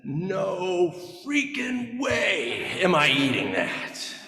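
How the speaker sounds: put-on slow, stubborn refusal from a little boy; kid's flat refusal. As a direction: drag it out, refusing. Little brother refuses the liver.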